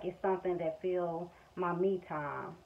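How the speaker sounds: ordinary talk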